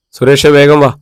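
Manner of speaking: urgent shout across the yard; calling out. Acted. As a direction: call him over fast. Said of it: "വിളിച്ചു പറഞ്ഞുകൊണ്ട്".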